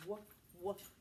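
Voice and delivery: put-on playful dog bark for kids; mock dog woofs. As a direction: bark it like a friendly puppy. Teacher barking like a dog.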